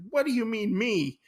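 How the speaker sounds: high-pitched retold protest; mock indignant disbelief. Gin blamed for the sunken boat, mock indignant.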